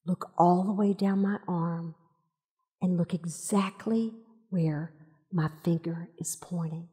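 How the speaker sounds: husband whispering beside his wife to guide her eye; gentle hushed guidance. Retold or imitated. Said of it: "He says".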